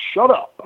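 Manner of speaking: "Shut up" punched high, imitating the wrestler; emphatic. Quoting the wrestler's catchphrase to the host, emphatic. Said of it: "with emphasis on "shut up".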